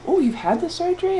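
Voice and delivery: high-pitched, wide-eyed mock fascination; imitating fascinated surgeons. Younger surgeons quizzing a patient at a party, mock fascination. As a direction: act out an eager, astonished question. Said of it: "fascinated to talk to me".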